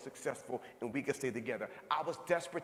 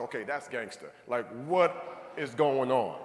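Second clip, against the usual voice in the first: stunned disbelief; 4 semitones lower, 9 dB louder, slower than usual. Retold or imitated. Husband's stunned reaction retold.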